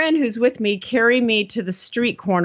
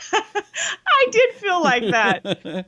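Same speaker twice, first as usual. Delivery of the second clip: laughing; 8 semitones higher, much slower than usual. Real, not acted. Laughing at the commercial joke.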